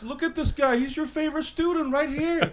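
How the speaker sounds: high-pitched teasing appeal to someone absent; mock calling out. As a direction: call upward to point out your friend, voice pitched high. Reporting friend to absent Marx.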